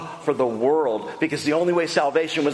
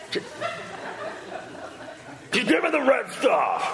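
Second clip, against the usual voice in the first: impatient shout; 11 semitones higher, 3 dB quieter than usual. Retold or imitated. Preacher acting Esau yelling at Jacob for stew. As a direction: yell for the food right now.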